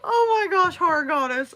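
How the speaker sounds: high, loud delighted cry; squealing with delight; excited squeal. Real, not acted. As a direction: gush over the compliment. Excited reaction to chat.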